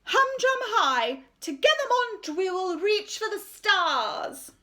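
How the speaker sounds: high, slow, self-important voice; pompous art teacher. Acted. Art teacher announcing her decision to the school, pompous and high. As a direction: preen over the assembly. Miss Conceit rallies the children.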